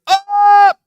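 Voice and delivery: long, high, very loud cry; falling scream. Retold or imitated. Friend falling down the furnace pipe, imitated scream.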